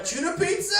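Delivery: delighted high cry, hammed up; mock excited shout. Jesus with the munchies finding food, excited shout. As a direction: shout it like a glorious discovery.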